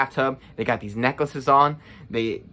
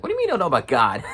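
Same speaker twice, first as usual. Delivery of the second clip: incredulous; 4 semitones higher, faster than usual. Retold or imitated. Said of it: "I'm just like".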